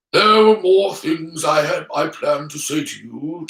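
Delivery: put-on measured old wizard voice; solemn Dumbledore. Dumbledore trying to keep Harry from leaving, solemn. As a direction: speak as a regretful headmaster, put-on voice.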